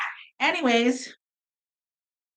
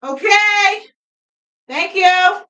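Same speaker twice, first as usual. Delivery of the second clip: calling out; 10 semitones higher, 7 dB louder, much slower than usual. Real, not acted. Calls to someone off mic.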